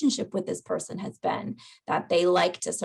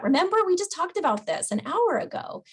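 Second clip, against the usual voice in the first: higher, a touch exasperated reminder; mock reminder; 10 semitones higher than usual. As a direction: gently scold about forgetting. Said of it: "very natural reaction from us".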